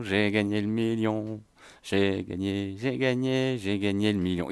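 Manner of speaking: softly repeating the win; quiet murmur to himself. Real, not acted.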